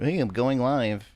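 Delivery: slow, drawn-out mock streamer greeting; imitating a streamer. Mocking a streamer who announces she's going live, slow. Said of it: "They're like".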